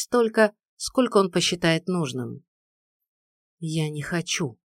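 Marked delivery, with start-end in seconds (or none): 3.6-4.6 three words let fall, softer; quiet, resigned drop; dropped quietly. A narrator's reading. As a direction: drop them softly, almost to yourself. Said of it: "уронила я три коротких слова".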